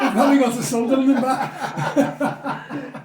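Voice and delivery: laughing loudly. Real, not acted. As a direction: cracks up at the joke. Joke about the wind.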